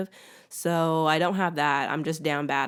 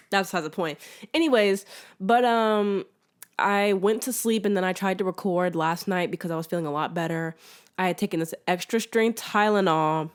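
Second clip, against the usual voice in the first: normal talking voice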